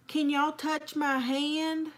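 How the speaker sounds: high, slow, spooky imitation; slow eerie ask, imitating her friend. Laughing friend retells the spooky question, slow eerie mimicry. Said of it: "when she's going".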